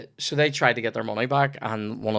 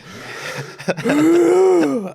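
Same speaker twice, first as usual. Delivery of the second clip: gasp; 6 dB louder than usual. Acted. Stewing in the flat.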